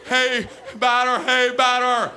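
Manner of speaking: loud, high, repetitive batter chant; infield chatter. Acted. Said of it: "Out in the field going".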